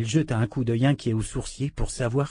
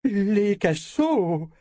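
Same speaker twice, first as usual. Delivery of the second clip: dismayed repeat; 8 semitones higher, much slower than usual. Acted. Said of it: "décomposée".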